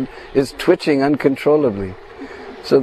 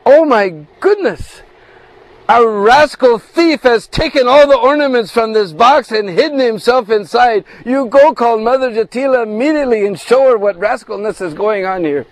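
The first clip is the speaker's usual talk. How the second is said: high, loud mock outrage; pretend anger; 7 semitones higher, 4 dB louder than usual. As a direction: feign indignation, much higher and louder. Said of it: "she pretends to become very angry".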